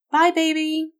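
high, loud singsong goodbye; forced cheerfulness. A narrator's reading. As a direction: try to sound cheerful for a child. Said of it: "Dana tried to sound cheerful".

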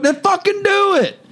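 high, heated ranting; exasperated rant at people who want to tag along; angry rant. Real, not acted. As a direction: tell them off, fired up and fed up. Ranting at would-be tagalongs.

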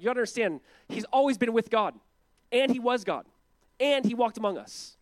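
high, loud, urgent proclamation; emphatic insistence. Acted. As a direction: drive each phrase home, pitched well up. Voices John insisting to listeners.

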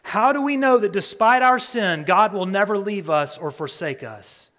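high, loud preaching cry; preaching climax. Real, not acted. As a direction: lift it up with passion.

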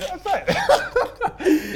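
high, loud, laughing through it. Real, not acted. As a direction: give in to the laugh. Laughing at the banter.

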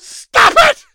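very high, loud comic shriek; mock-outraged scream. Co-host ordering Kobayashi to quit, screamed mock outrage. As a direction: scream it as a fake tantrum.

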